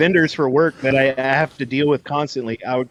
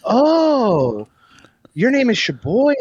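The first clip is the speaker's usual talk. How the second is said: much higher, astonished realization; imitated dawning surprise; 9 semitones higher, slower than usual. Vendor meeting him in person at last, high mock surprise. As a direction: mimic her as it suddenly clicks. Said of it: "And she's like".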